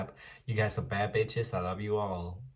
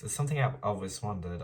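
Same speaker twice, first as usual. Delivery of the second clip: ordinary talk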